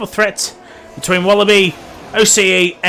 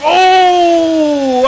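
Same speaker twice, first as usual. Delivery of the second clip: surprised gasp; 8 semitones higher, 6 dB louder than usual. Real, not acted. Near spin seen live.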